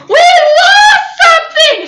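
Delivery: high loud slow shout; mock-urgent announcement shout; giddy shout. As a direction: burst in yelling it. Bursting into roommate's room.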